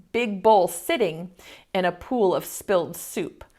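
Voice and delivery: ordinary delivery, nothing marked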